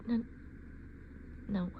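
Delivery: ordinary talk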